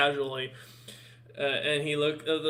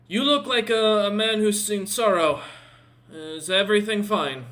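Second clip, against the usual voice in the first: concerned orc warrior; 6 semitones higher, 6 dB louder than usual. Acted. Orc asks about sorrow.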